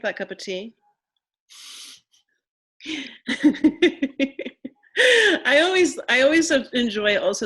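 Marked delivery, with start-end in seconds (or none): 2.8-4.7 loud, high burst of laughter; real laugh, pitched up. Real, not acted. Laughter after the question. 5.5-7.5 high, loud start through laughter; real laugh spilling into the words; laughing while talking. Real, not acted.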